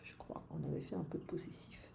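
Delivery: quick unvoiced whisper to herself; soft whispered reminder; whispered aside. Real, not acted. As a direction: mutter it under your breath, fast. Teacher's muttered aside to herself.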